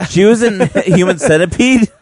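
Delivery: high, loud laughing question; laughing disbelief. Real, not acted. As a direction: laugh through the surprise.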